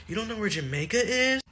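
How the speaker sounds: high, incredulous teacher; teacher asking the boy in disbelief, high; mock disbelief. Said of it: "Teacher was like".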